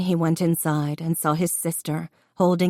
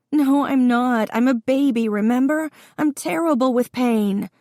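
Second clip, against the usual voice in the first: high, louder labor-pain wail in words; panicky protest; 8 semitones higher, 3 dB louder than usual. A narrator's reading.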